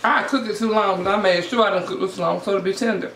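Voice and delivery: speaking with food in mouth; words muffled by chewing; talking while chewing. Real, not acted. Seen 0: Took a taco bite earlier; lips pursed, jaw working while talking.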